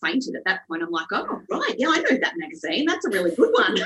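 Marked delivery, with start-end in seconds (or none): 1.1-3.8 high "oh right", retold mock casual; feigned nonchalance. Stylist-to-be hearing of Vogue, feigned nonchalance. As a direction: play it cool, breaking into a laugh.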